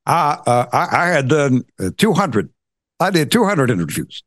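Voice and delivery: lightly voiced Obama; a hint of Obama's halting delivery; Obama impression. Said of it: "I'm Donald Trump".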